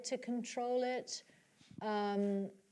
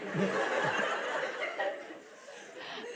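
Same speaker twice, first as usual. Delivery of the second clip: real amused laugh; breathy laugh; 2 semitones lower than usual. Real, not acted. Laughs after asking.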